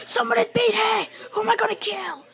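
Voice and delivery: high, strained put-on voice; mock hunter voice. Playful hunt for a player to kill.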